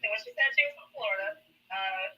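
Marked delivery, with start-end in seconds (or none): none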